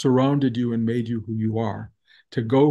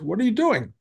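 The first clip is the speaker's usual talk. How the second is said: higher-pitched imitation of a stern challenge; mock indignant demand, pitched up; retold scolding; 8 semitones higher than usual. Teacher walking in on the struggling cook, retold scolding. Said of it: "he's yelling at me".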